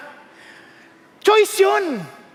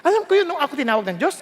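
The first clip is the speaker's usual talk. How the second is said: normal talking voice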